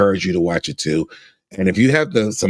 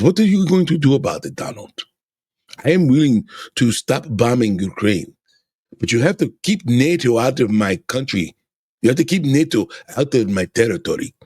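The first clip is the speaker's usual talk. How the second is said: bad Russian accent, pushing hard on the demand; 5 semitones higher than usual. Vlogger as Putin making demands of Trump, bad Russian accent. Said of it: "I probably sound horrible with my accent".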